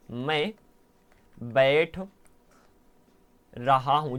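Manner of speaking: each word set apart by long pauses; slow word by word. Shown as a demonstration. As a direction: spell it out slowly, one word at a time. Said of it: "यहाँ पर ध्यान सुनो". (धीरे धीरे, हर शब्द अलग करके)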